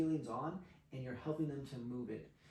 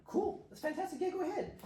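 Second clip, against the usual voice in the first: high-pitched agreeable. Retold or imitated. Acting own eager reply to her.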